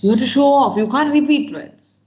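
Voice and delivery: insistent teacher push; emphatic urging. Real, not acted. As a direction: drive the advice home to the class. Teacher stressing key exam advice.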